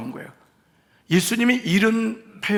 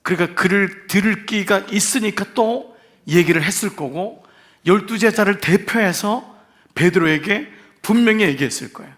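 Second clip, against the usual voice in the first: ordinary delivery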